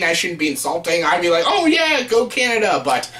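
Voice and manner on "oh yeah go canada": high-pitched token cheer for Canada; put-on patriotic enthusiasm; mock cheering. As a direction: pretend to root for your country, pitched way up.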